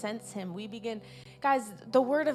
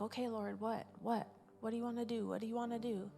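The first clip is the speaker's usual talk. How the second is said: hushed, much softer retold plea; retold quiet prayer; 8 dB quieter, faster than usual. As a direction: softly imitate someone asking God, repeating the question. Retelling a man's quiet prayer.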